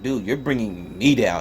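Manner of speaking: low, mopey mock Batman line; lightly voiced depressed Batman. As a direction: sulk it like a gloomy Batman. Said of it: "the way he does it is so depressing".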